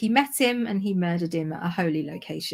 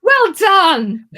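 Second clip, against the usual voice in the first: high, loud burst of praise; loud congratulation; 11 semitones higher, 11 dB louder than usual. Real, not acted. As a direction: cheer them on loudly for getting it. Cheering co-host's corrected name reading.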